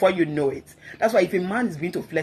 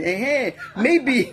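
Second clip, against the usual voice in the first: laughing mock; 4 semitones higher, much slower than usual. Mocking a jealous husband.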